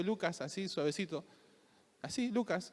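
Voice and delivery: saying a name very softly to wake someone; softly, gently. Shown as a demonstration. Pastor shows how softly his name wakes him, very soft. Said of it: "decir mi nombre despacito".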